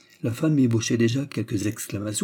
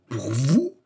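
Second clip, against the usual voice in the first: higher, rising astonished question; utterly surprised; 4 semitones higher than usual. A narrator's reading. Manager interrupting the suitor, greatly surprised. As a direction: cut in with astonishment. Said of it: "au comble de la surprise".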